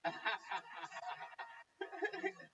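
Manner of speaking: amused, drawn-out laughter; laughing. Real, not acted. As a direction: laugh it out at length. Amused at British visitors joke.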